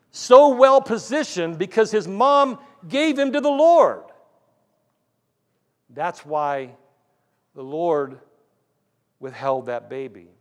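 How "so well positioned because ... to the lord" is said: higher, louder pulpit climax; ringing sermon emphasis, stretching the last words; emphatic preaching. Real, not acted. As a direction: drive the point home to the congregation. Preacher drives main point home.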